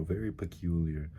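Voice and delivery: normal talking voice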